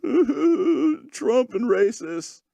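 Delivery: high, drawn-out mock crying; finto pianto. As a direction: wail it slowly like a blubbering crybaby. Mocks two people crying together.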